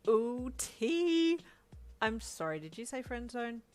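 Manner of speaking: gossipy delight. Acted. Uncle thrilled by juicy gossip, excited. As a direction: pounce on the gossip.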